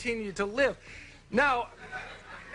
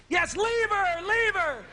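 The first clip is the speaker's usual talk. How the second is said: put-on kids yelling; 12 semitones higher, slower than usual. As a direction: shout it like boys egging on the monster. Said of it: "little boys in the audience are yelling".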